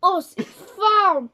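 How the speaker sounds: loud, slow cry of pain; drawn-out loud cry. Real, not acted. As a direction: drag it out loud as the heat hits. Burning spice taste reaction.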